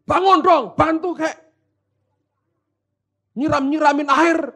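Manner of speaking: high, exasperated demand; angry scolding. Acted. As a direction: rouse a sleeper who won't help. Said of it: "Mereka marah. Yesus dihardik".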